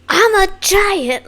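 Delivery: high, slow, full-force mimic of the line; forceful imitation. Fan imitating the rapper's forceful line, high and slow. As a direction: belt it like the singer does. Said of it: "they're really going full force with it".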